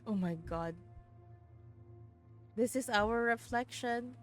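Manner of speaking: plain reading voice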